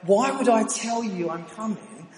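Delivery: high, loud, drawn-out incredulity; indignant retort. Retold or imitated. Answering the stranger on loudspeaker for his mates, indignant. Retelling quarrel with unknown caller.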